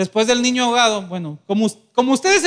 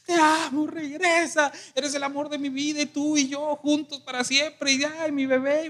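higher, softer mock lament over a coffin; mock wailing son; 4 semitones higher, 5 dB quieter than usual. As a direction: imitate a grieving son calling out to his mother. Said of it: "un muchacho estaba gritándole".

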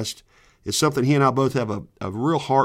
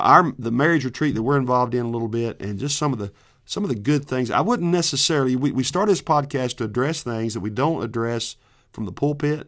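ordinary delivery

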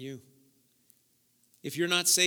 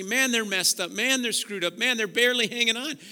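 mock dismissive onlooker; 6 semitones higher, 8 dB louder than usual. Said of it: "see people in their brokenness and say".

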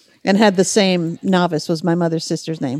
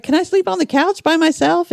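put-on higher-pitched, mildly pleading kid's question; pleading child; 10 semitones higher than usual. Kid asking parents for the couch, lightly acted plea.

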